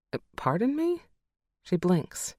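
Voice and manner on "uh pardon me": high, startled pardon; a mom caught off guard by a strange question; puzzled surprise. Acted. Mom caught off guard, puzzled.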